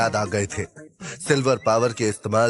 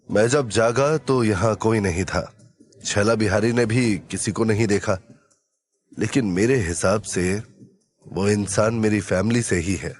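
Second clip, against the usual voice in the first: low, measured hero's voice; thoughtful hero; 4 semitones lower than usual. Acted. Hero telling companions who the stranger was, thoughtful. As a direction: answer low, pausing to reason.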